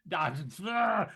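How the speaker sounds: loud, high frustrated yells; acted roars of frustrated fury; furious battle grunts. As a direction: grunt loudly as if swinging wildly. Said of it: "just furiously".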